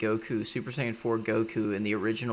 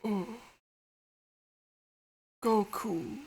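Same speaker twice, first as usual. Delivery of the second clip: much higher, lovestruck sigh; swooning Vegeta; 13 semitones higher, slower than usual. Retold or imitated. Vegeta swooning over Goku, high falsetto. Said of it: "He's all like".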